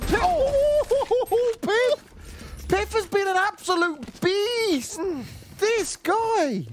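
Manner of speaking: high, drawn-out ecstatic shriek over the play; excited scream. Real, not acted. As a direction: scream his name in disbelief, way up high. Caster erupts at a big play.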